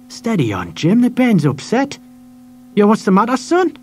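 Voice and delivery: put-on calm the shopkeeper, comfort the boy; kind woman.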